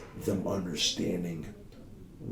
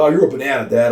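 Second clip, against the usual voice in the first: put-on higher, louder kid voice; little son's voice; 6 semitones higher, 13 dB louder, faster than usual. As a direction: play the four-year-old, giddy and loud. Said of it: "He's like".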